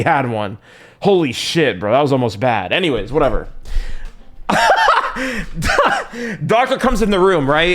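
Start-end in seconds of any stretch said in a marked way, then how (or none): 3.5-6.4 helpless high cackle of relief; high-pitched laughing fit. Real, not acted. Streamer cracking up after a near slip, high laughing fit. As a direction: burst into a long, high laugh.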